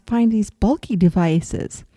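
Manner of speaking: ordinary talk, nothing marked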